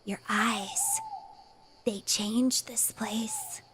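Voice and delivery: put-on slow eerie whisper of a strange child; creepy kid whisper. Creepy boy whispering to the player about her eyes. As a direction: breathe it like an unsettling little boy.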